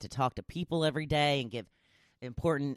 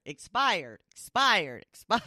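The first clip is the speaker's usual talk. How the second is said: lightly voiced aunt, higher and louder each time; mock aunt's complaint; 5 semitones higher, 5 dB louder than usual. Imitating Geico ad aunt in the refrigerator, mock calling out. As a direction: imitate a commercial's nagging aunt. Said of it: "like my Aunt Claire, she's in the refrigerator".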